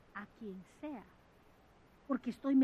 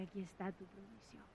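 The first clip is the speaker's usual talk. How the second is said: soft divine assurance; 6 semitones lower, 18 dB quieter than usual. Pretend. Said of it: "y te dice".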